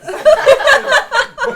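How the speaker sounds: loud, high cackle of laughter; high shrieking laugh. Real, not acted. Cast laughing at blooper.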